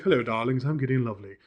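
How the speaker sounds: a hint of a tea-drinking old gentleman; Gideon Lovely. Wizard greeting the party over tea, lightly voiced as a low, soft older gentleman. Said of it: "he's always drinking tea".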